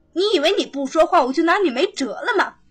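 fast, high, sneering threat; menacing threat. Acted. Nanny threatens the silent maid, fast and sneering. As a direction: rattle it off with cruel glee.

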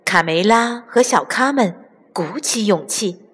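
ordinary delivery

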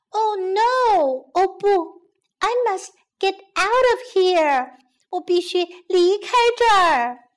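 a small bee panicking to escape; alarmed. Acted.

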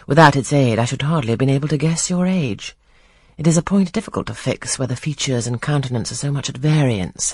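plain reading voice

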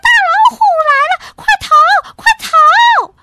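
very high, fast, loud panic cry; panicked animals. A narrator's reading. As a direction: shriek a warning as you flee. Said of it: "小动物们吓得四散奔逃".

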